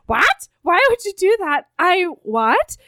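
retold disbelief, voice rising into questions; confused. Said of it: "really confused as well".

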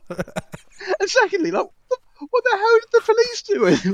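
veel hoger, langzaam ongelovig; incredulous. Real, not acted. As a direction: stretch it out high in disbelief. Incredulous mock outrage.

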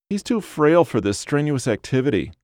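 high whiny fussing; whiny wife. A narrator's reading. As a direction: fret about your husband in a high whine. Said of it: "the whiny voice".

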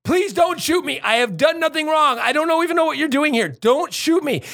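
high, begging plea; desperate pleading. Retold or imitated. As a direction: beg them not to shoot. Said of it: "he was like a thousand times".

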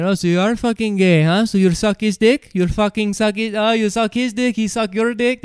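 loud, high imitation of rowdy taunts; mock jeering. Retold gang piling on insults.

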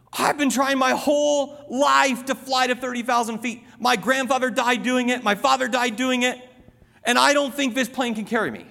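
high-pitched, insistent comic distress; panicking little fly. Acted. Fly confides his fear of flying to a passenger, high mock panic. Acts out the panicking fly's plight.

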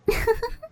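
high, soft giggle; teasing giggle. A narrator's reading. Character teases with a giggle.

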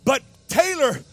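loud, high, driving sermon climb; preaching at full force. Acted. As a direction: preach it hard, building to the peak.

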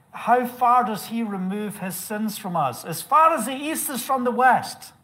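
declaimed scripture. Real, not acted. Preacher declaims quoted psalm.

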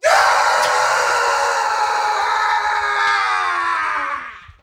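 long scream. Real, not acted. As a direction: scream it all out, then crack up. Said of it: "All right, here we go".

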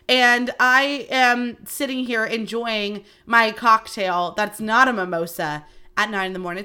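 ordinary talk

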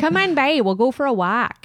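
lightly hammed-up Wisconsin accent. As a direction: put on a Wisconsin accent, starting high. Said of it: "Is that the Wisconsin accent".

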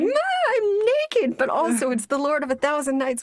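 very high mock shriek, drawn out; hammed-up flustered squeal. Naked bathers caught by the lord, mock flustered squeal. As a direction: squeal it up high, playing embarrassed.

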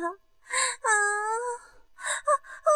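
high breath-led moans; acted moans. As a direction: moan in short high bursts. Acted moans in erotic scene.

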